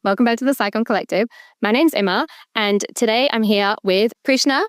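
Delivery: ordinary talk, nothing marked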